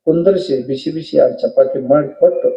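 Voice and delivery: ordinary talk, nothing marked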